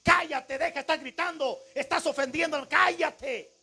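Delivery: high, forceful mock rebuke; acted scolding. Crowd telling Bartimeo to shut up, acted scolding.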